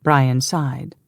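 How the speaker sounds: plain reading voice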